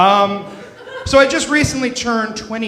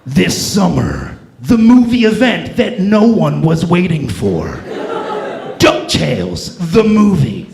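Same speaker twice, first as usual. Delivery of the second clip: movie trailer voice; 3 dB louder, slower than usual. Pretend. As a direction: boom it like a blockbuster trailer. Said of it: "go a little something like this".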